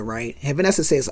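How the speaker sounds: ordinary delivery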